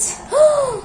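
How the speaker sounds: sharp high gasp; surprised gasp. Acted. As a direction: gasp in delight.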